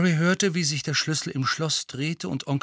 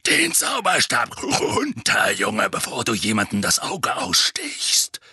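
gruff, dark growl; 4 dB louder than usual. Acted. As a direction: growl it like a battle-scarred old soldier. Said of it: "sagte eine dunkle, knurrende Stimme".

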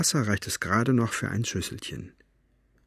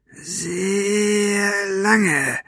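high, long-stretched menacing drawl; drawn-out word; 11 semitones higher than usual. A narrator's reading. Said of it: "Buster dehnte das Wort". (hoch und bedrohlich in die Länge gezogen)

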